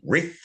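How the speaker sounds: Rith said high with an R on the front; Scoobified word. Shown as a demonstration. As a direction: Rith as Scooby says it, R added before the word. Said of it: "it's gotta be R I T H".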